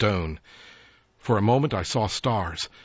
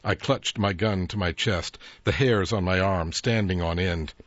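plain reading voice

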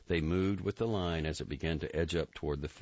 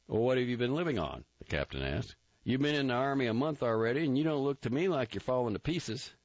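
captain's voice; 9 semitones higher, faster than usual. A narrator's reading. Captain taunting a recruit about his mother, mocking higher voice. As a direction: needle him like a scornful captain.

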